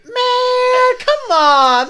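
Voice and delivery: high, loud whiny protest; whiny Jacoby. Retold or imitated. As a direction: whine it like a caught kid. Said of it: "He's like".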